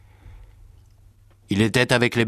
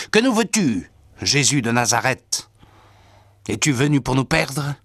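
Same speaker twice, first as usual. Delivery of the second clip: raised, high-pitched narrated outcry; crying out; 5 semitones higher, 3 dB louder than usual. A narrator's reading. Possessed man challenging Jesus in the synagogue, crying out. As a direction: cry out in a storyteller's raised voice. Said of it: "il se met à crier".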